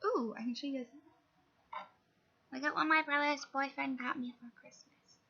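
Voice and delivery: ordinary delivery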